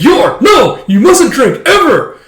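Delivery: high, loud mock panic; scandalized brother's outburst, climbing at the end; appalled shouting. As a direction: forbid it in a hammed-up yell. Brother forbids drinking, waiter objects.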